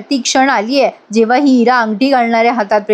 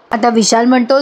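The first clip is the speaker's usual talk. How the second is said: ordinary delivery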